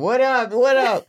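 high, loud street greeting; re-enacted excited shout to someone passing; calling out. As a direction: holler a hello across the road. Retold calling out to passers-by.

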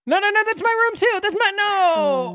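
high, stretched wail of objection; panicked protest. Acted. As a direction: cry out as if being locked out of the room. Acted protest at being locked out.

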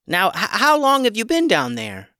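put-on talking chicken asking the boy; chicken's voice. A narrator's reading. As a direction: call down into the well.

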